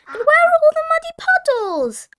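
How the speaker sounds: very high, loud little piglet voice; Peppa Pig. A narrator's reading. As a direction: squeal the question like a small child. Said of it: "asked Peppa".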